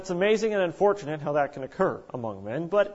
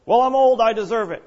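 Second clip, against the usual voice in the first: louder, higher mock entitled elder; mock grumpy old person, louder; cranky senior; 6 semitones higher, 8 dB louder than usual. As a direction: huff like a grumpy old person who's owed. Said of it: "senior citizens aren't allowed to get cranky".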